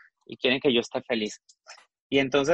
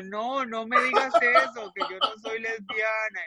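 higher pitched, giggling refusal; laughing denial; 8 semitones higher than usual. Retold or imitated. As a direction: deny it while laughing at herself. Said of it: "Le digo".

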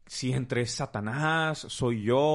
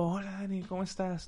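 higher, softer, coaxing greeting; a woman trying to pass as the wife, higher and softer; flirty stranger's voice; 6 semitones higher, 5 dB quieter than usual. Retold or imitated. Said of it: "Con una voz así".

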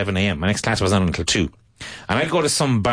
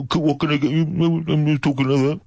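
Birmingham; 5 semitones higher than usual. Retold or imitated. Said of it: "a city like, like Birmingham".

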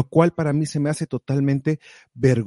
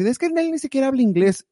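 mock yell; 9 semitones higher, faster than usual. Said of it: "Gente gritándome así".

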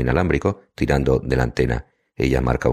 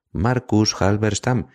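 very slow, higher-pitched, voiced prompt; drawn-out name, pitched up, not truly whispered; narrated whisper; 9 semitones higher, much slower than usual. A narrator's reading. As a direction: stretch out MARCUS HALBERSTAM syllable by syllable. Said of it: "le vuelvo a susurrar".

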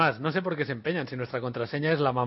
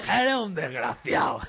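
laughing; 7 semitones higher, slower than usual. Real, not acted. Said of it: "Pero es súper despectivo".

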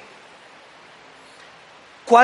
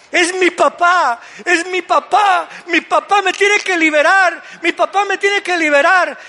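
put-on child's plea; 8 semitones higher, 3 dB louder than usual. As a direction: plead like a small son clinging to dad. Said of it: "La solución de este hijo era el papá". (hijo que suplica, voz aguda y lenta)

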